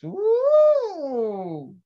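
excited whoop. Real, not acted. Cheering at Max's news.

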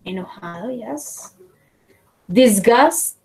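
usual speaking voice

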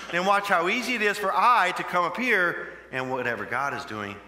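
ordinary talk, nothing marked

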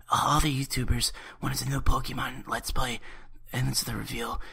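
usual speaking voice

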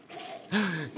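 sharp gasp. Real, not acted. Fight continues.

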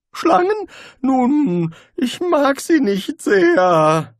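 frightened wizard blurting out a secret; nervous wizard. A narrator's reading. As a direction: blurt it out, anxious and high. Rincewind voiced by narrator.

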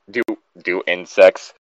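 ordinary delivery

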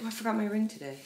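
ordinary talk

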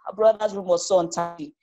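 ordinary delivery, nothing marked

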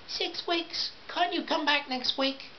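high-pitched dismayed customer; protesting housewife. A narrator's reading. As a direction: protest softly in a high put-on voice.

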